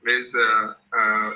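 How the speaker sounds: normal talking voice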